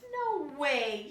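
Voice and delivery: high, loud shocked gasp. Acted. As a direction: react in disbelief. Lydia shocked.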